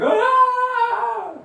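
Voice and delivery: a sudden high wailing cry; high wailing scream. Retold or imitated. Lady from Bengal breaking the silence, wailing scream. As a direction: let out a piercing, high wail. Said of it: "she started screaming".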